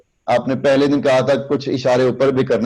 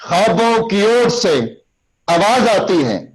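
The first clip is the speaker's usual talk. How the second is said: declaiming demo; 7 semitones higher than usual. Shown as a demonstration. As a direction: recite it with a lifted voice. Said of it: "تب".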